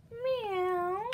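high, sung-out meow; cat meow. Acted. Cat answering Duck, high drawn meow. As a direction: mew it like a cat. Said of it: "said Cat".

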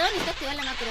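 ordinary talk

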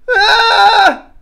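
high, loud sustained scream; imitated scream. Man screaming outside the hotel, imitated high yell. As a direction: let out one long high "ah" shout. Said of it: "homeless people screaming".

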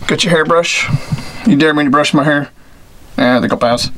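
ordinary talk

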